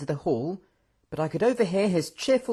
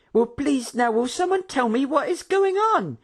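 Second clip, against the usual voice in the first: bewildered hostess; 13 semitones higher, 4 dB louder than usual. A narrator's reading. Karina voiced, bewildered.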